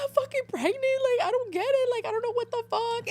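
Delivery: very high, whiny mock wail; mock crying. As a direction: act out a panicked, tearful confession to mom. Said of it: "I was literally crying".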